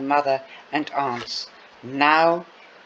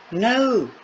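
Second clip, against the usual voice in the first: a high, sharp shocked no; 8 semitones higher than usual. Acted. Two friends hearing the kids leave home, shocked high no. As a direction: recoil in disbelief. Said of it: "say in unison".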